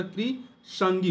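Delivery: "Krishangi" said slowly and clearly; slow correct form. Shown as a demonstration. Teacher to students, slowly giving the correct form Krishangi. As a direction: stretch out "Krishangi" carefully. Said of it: "कृषांगिनी नहीं होता".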